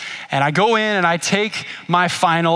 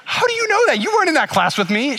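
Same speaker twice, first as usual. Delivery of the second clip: surprised; 11 semitones higher, faster than usual. Retold or imitated. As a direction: pitch jumps very high on "How do you know that?". Surprised wife knows failure.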